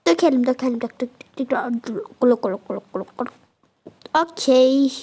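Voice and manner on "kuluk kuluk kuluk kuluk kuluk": low, playful kuluk kuluk pouring sound; low, fading water gurgle; gurgling noise. Pretend.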